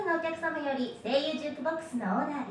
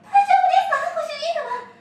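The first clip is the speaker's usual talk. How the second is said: alarmed maid; 10 semitones higher, 10 dB louder than usual. Acted.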